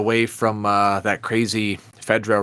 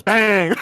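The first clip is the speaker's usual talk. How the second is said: imitated gunshot; 10 semitones higher, 4 dB louder than usual.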